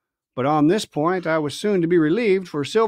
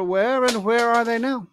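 sly pirate; 6 semitones higher than usual. Acted. Silver retort.

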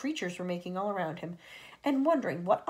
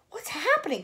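high startled question; startled boy's cry; alarmed cry; 10 semitones higher, 4 dB louder than usual. A narrator's reading. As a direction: cry out in confusion. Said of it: "cried James".

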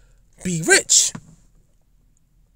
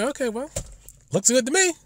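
higher, breezy careless sign-off; lightly voiced careless president, higher; naive president voice; 6 semitones higher, 3 dB quieter than usual. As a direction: play her as a president who signs without reading. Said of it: "She might say".

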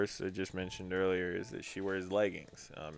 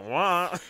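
mock brain-fail noise; 10 semitones higher, 9 dB louder than usual. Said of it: "your brain just went".

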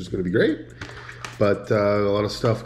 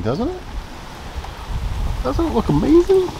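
excited; 3 dB louder than usual. Real, not acted. Showing off the lawn proudly.

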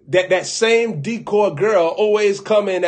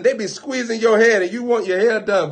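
ordinary delivery

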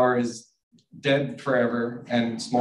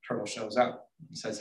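ordinary delivery

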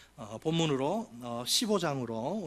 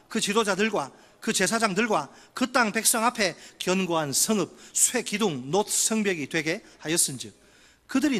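scripture reading; 4 dB louder than usual. Acted. Pastor reading Jeremiah aloud to the congregation, solemn proclaiming.

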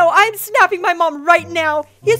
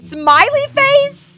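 high, loud, slow disbelief; can't believe what he just typed; stunned outraged question; incredulous; 8 semitones higher, 6 dB louder, much slower than usual. Real, not acted. Reacting to creepy chat message.

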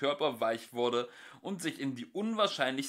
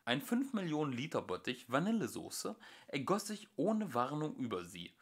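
normal talking voice

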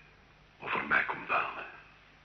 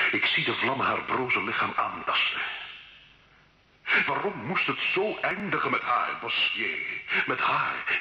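rising, much higher and louder cry of despair; anguished despair; 8 semitones higher, 3 dB louder than usual. Acted. Gilles to the priest, reliving Jeanne's burning, anguished despairing cry. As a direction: let the anguish climb until it breaks.

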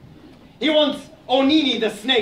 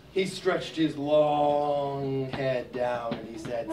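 slow, low narration; 7 semitones lower, 7 dB quieter, slower than usual. A narrator's reading. Snake stretches down.

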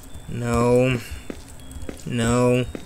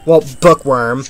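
ordinary talk

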